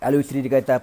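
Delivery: ordinary delivery, nothing marked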